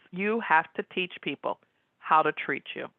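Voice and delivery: usual speaking voice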